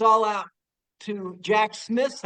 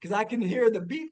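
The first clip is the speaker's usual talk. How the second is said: usual speaking voice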